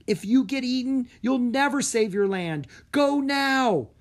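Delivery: loud, high, pressing plea; urgent pleading. A narrator's reading. Breen begging Drake to flee, loud and urgent. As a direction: push him to go now.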